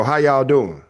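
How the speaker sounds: loud hammed-up Alabama comic; thick Alabama accent. Comic taking the stage, exaggerated Alabama accent. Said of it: "I walk out and I go".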